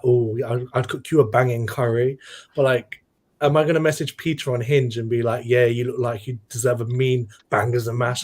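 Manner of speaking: ordinary delivery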